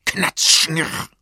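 hohes, lautes Nachahmen der hochschießenden Jalousie; lautmalerischer Knall. A narrator's reading. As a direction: lass es plötzlich knallen und schnurren. Jalousie schießt knallend nach oben. (lautmalerisch, schlagartig laut)

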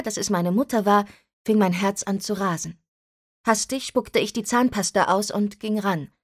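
ordinary delivery

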